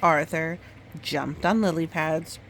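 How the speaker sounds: gentle storybook read-aloud; reading to a child. Acted. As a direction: tell it like a bedtime story. Mother reads picture book aloud.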